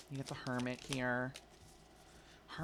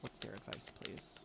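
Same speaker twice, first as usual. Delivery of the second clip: much softer, hushed request; quiet; 4 semitones lower, 10 dB quieter than usual. Real, not acted. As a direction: ask the deck gently under your breath. Softly asking the deck again.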